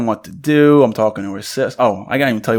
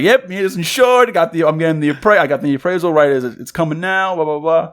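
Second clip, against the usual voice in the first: excited high retold phone voice; 6 semitones higher than usual. Retelling a phone call.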